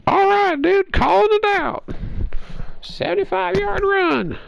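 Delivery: high, loud cocky call-out; mock trash-talk shout. Gamer retelling how he taunted his opponent, high loud mock shout. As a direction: yell the play at him like a taunt.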